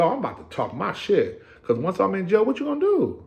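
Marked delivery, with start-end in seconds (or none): 1.6-3.2 taunting. Retold or imitated. Taunting the officers.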